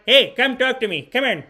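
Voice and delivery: high, loud call from downstairs; uncle calling out. Retold or imitated. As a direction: holler up the stairs like the uncle. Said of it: "he'd be like".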